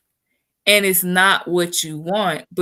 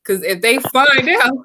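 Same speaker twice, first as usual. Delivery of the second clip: giggling through the words, higher pitch; laughing; 7 semitones higher, 3 dB louder than usual. Real, not acted. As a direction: laugh as you admit it, slowing at the end. Confessing weakness, laughing.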